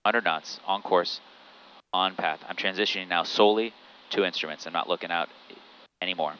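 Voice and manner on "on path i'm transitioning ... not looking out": ordinary talk, nothing marked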